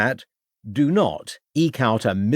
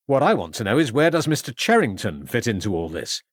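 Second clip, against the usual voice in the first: ordinary talk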